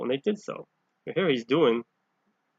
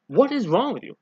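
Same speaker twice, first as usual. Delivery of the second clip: loud, high, exasperated scolding; chastising outburst; 9 semitones higher, 6 dB louder than usual. Retold or imitated. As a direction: throw it at them like a fed-up teacher. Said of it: "He's chastising them".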